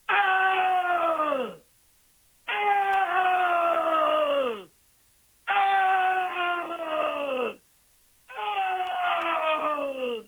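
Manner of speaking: repeated high bellowing Oh sounds; bear's bellow. Retold or imitated. Sheep herder imitating the bear in her flock, loud bellows. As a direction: bellow each Oh like a bear with its prey. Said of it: "I heard him go".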